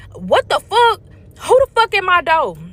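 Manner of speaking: loud, high-pitched angry demand; pissed off. Retold or imitated. Woman yelling at whoever keeps knocking, pissed off. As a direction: re-enact fed-up cussing at the door. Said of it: "So I'm pissed".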